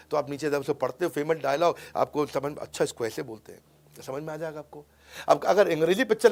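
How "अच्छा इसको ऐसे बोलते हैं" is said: quiet realisation. Acted. Voices viewer quietly realising the translation.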